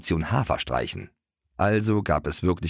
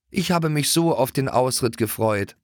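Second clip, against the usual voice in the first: sighing; 6 semitones higher than usual. Acted. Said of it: "seufzte Greta".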